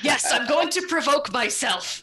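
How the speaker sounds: loud, high and slow mock declaration; big, drawn-out sarcastic yell; mock outburst. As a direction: blurt it out with hammed-up exasperation. Mock retort to a teasing suggestion.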